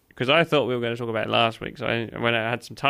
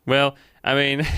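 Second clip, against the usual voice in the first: ordinary talk, nothing marked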